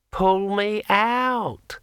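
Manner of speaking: very high, slow glassy voice; drawn out like a ringing wine glass; talking sword. A narrator's reading. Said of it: "in a voice like the sound of a finger dragged around the rim".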